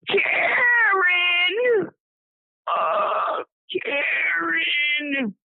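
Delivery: high, drawn-out wail, imitated without extra volume; dying man's scream. Dying man screaming out for his wife, imitated high drawn-out cry. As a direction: imitate a wounded man calling out in long, high cries. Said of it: "screaming out for his wife".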